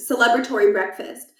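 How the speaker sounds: ordinary delivery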